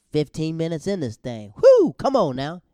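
loud, high burst of hype; excited. Real, not acted. Host hyping himself up alone, loud excited shout. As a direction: shout it out, really pumped. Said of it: "sorry, I get a little excited".

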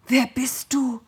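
horrified cry. A narrator's reading. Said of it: "hörte ich Isaak entsetzt rufen".

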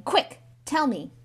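impatient, agitated command; urgent demand. Acted. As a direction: press him for an answer. Filch demands an answer.

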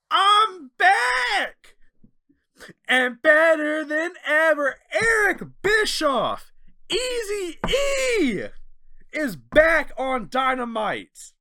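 hype announcer. Retold or imitated. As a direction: belt out the big return like an arena host. Announcing Bischoff's return on show.

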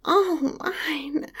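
hushed possessive whisper. Acted.

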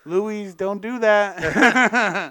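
slow put-on dog voice. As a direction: talk slowly as the dog. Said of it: "My dog says this".

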